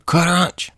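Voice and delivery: high, punched crunch sound effect; spoken crunch noise; imitated crash. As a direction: voice the smash like a comic-book effect.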